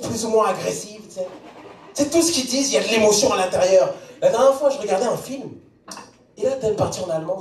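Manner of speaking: ordinary talk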